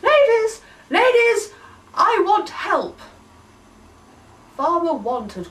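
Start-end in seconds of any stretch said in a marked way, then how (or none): 0.0-3.0 much higher, louder yelp for attention; yelping call. Acted. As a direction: yelp across the field for help. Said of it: "a voice behind them yelped".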